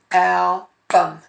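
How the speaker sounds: album, clapped by syllable; teacher clapping album for the class; syllable tapping. Shown as a demonstration. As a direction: clap album out. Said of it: "where you think you would divide the word album".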